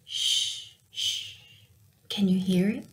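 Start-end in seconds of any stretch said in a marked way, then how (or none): 0.0-1.3 breathy unvoiced shh; gentle shushing; soft shush. A narrator's reading. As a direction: hush like a secret. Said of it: "The last noise at night".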